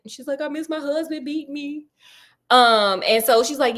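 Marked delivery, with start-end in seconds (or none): 0.4-1.9 softer, higher pitch, lightly weepy; half-hearted put-on crying; mock tearful wife. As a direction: imitate an upset widow in a small voice. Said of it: "she's over there crying".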